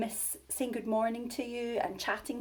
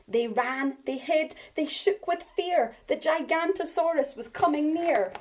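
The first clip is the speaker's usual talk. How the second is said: rising scared refrain; 7 semitones higher, 6 dB louder than usual. A narrator's reading.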